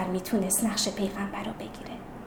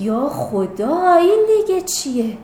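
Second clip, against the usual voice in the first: slow, stretched cry of amazement; astonished; 4 semitones higher, 5 dB louder, slower than usual. Acted. Girl seeing inside the orange, astonished. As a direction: stretch it out, then rise high and loud. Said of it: "هین متعجب و بلندی کشید".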